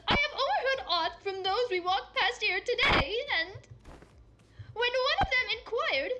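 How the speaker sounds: high, loud archaic knight, laughing; breathless chivalric excitement; excited olde knight. Acted. Don Quixote speaks.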